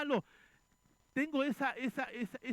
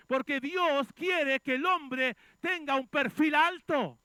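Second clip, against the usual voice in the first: louder, rising pulpit cry, some lines slowed; fiery preaching; 5 semitones higher, 6 dB louder than usual. Acted. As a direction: preach it out to the congregation. Preaching at full force.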